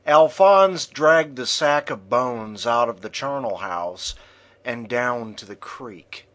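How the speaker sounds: ordinary delivery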